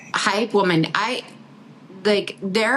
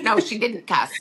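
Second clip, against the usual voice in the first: normal talking voice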